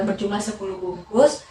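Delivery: usual speaking voice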